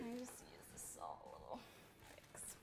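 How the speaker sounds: breathy whispered request; whispering. Real, not acted. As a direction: ask quietly in a whisper. Whispered request during cooking.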